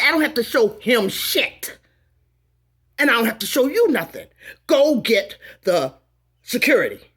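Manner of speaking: loud, heated, indignant refusal; angry retort. Retold or imitated. Refusing to show the receipt, she fires back loud and angry. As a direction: slap the demand back at him, loud.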